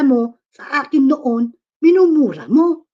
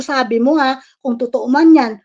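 ordinary delivery

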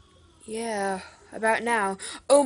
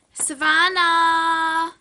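calling out; 5 semitones higher, 8 dB louder, much slower than usual. Acted. Calling sister from another room.

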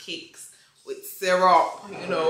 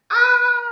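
high, loud joyful squeal; excited squeal; 10 dB louder than usual. Real, not acted. Birthday girl squealing with joy at her viewers. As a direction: let out a delighted shriek.

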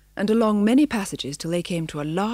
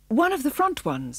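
higher, bright and eager; 7 semitones higher than usual. A narrator's reading. Missus answering the spaniel's riddle, bright. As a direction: chirp the answer proudly. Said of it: "said Missus brightly".

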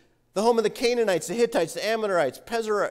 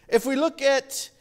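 usual speaking voice

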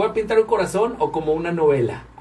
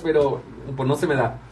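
ordinary talk, nothing marked

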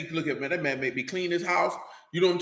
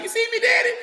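mock kid asking daddy. Kid jumping on furniture, mocked.

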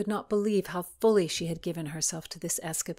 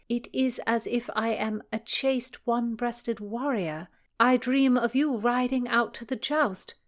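higher, measured, ardent recital; love-letter reading; 6 semitones higher, 5 dB louder than usual. A narrator's reading. Philomena reading another love letter aloud, ardent recital. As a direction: declaim the letter in a raised voice.

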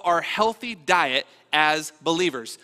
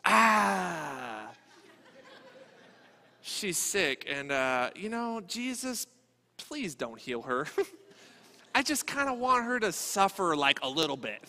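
mock Peter; 3 semitones lower, 5 dB quieter, slower than usual. Peter voiced begging Jesus.

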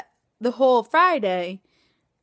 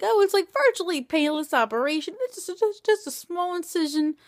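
higher dismissive mock surgeon voice; lightly mocked surgeon shrugging off pain; 7 semitones higher than usual. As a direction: play down the operation airily. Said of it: "the surgeon's like".

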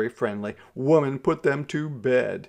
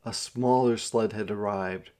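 plain reading voice

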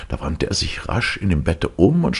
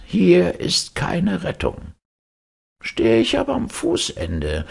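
kindly Death, voiced much higher; Death's voice; 11 semitones higher than usual. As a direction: speak as gentle Death, pitched up. Death instructs his godson.

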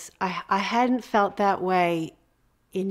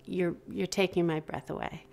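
softer, tender and sincere; soft sincere admiration; tender praise; 7 dB quieter than usual. Real, not acted. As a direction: gently tell her she's stunning. Affirming another woman.

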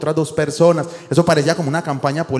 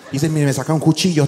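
ordinary talk

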